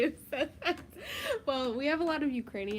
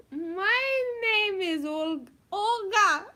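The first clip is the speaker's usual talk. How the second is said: mock Russian accent; 7 semitones higher, 7 dB louder, much slower than usual. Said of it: "Russian is like".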